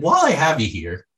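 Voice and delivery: a hint of an eager schoolkid speaking up; second-grader asking. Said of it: "however a second grader or third grader would say that".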